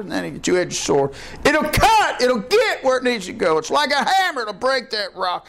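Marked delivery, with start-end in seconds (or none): 1.4-5.5 preaching at full force. Acted. Preacher hammering the Word to the congregation, fiery. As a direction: thunder it from the pulpit.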